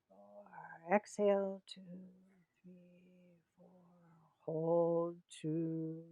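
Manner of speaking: firm cues, counts fading to a near whisper; guided breathing count. Acted. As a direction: pace the breath slowly, letting each number fade. Leads the breathing round again.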